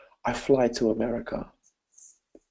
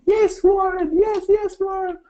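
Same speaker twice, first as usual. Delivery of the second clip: put-on high, louder eager child's shout; eager little girl; 5 dB louder than usual. The girl shouts back to coach Warren in a high eager child's voice. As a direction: pipe up keen to please the coach.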